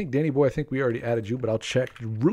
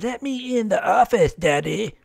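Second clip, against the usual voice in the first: high, put-on voice of a pet dog; high-pitched talking-dog impression; pleading dog voice; 9 semitones higher than usual. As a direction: voice your dog begging Daddy to let her in. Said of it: "that's exactly how she sounds".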